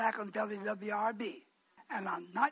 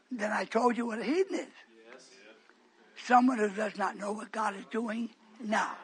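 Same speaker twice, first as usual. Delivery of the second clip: ordinary delivery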